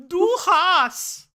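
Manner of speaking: loud, high, drawn-out mock German; bad German accent. Casting about for German words, loud drawn-out German accent. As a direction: belt out slow, hammed-up German. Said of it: "French saying German words".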